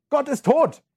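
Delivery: high, loud proclamation; proclaim. Acted. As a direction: hurl it out as the climax. Climax of dramatic reading. (laut und hoch ausgerufen)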